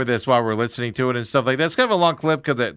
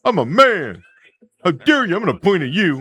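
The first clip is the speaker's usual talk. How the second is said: higher and louder, puffed-up blustering; hammed-up macho rant; mock tough guy; 4 semitones higher, 3 dB louder than usual. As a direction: parody a hothead showing off how tough he is. Mocking the manager's tough-guy act.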